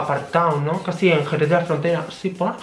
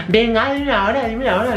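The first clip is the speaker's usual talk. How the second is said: higher, pushy demand for the time; mock demand; 6 semitones higher than usual. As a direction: press her for the time, mimicking. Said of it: "se me puso a decir". (exigencia imitada y más aguda)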